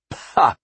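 short, scornful "pah"; dismissive grunt. A narrator's reading. Father dismisses daughter's reluctance, short dismissive grunt. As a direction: wave it away with a grunt. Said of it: "said dismissively, an almost human-like grunt".

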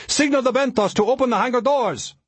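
very high, alarmed instruction; urgent warning. A narrator's reading. Ari urging a radio call for rescue, urgent cry. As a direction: press the pilot with pitch held high. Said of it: "Ari warned".

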